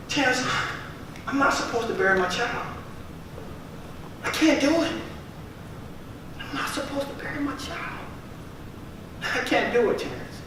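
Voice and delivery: a hint of the mother's tearful plea; retold crying mother. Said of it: "on the other end of the phone crying".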